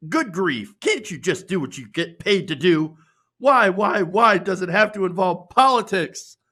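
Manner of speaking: high, loud whining complaint; hammed-up whine of an offended fan; whiny outraged fan. Reading a fan's complaint aloud, mock whining. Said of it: "this one says".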